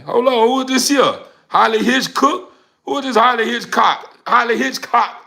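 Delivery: high, hyped-up discovery of a newcomer; excited greeting. Real, not acted. Streamer spots a new name, excited high call. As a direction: hail them like a big arrival.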